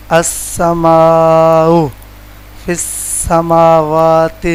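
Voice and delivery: very slow, drawn out; teacher reciting example words slowly; slow Quranic words. Shown as a demonstration. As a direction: stretch السماء and فی السماوات slowly. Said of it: "teacher reads example words".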